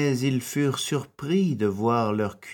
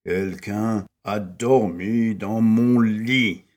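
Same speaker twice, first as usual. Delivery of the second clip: roaring Papa Bear; 5 dB louder than usual. A narrator's reading. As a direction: growl it slowly like an angry papa bear. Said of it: "rugit Papa Ours". (Papa Ours qui rugit, lent et fort)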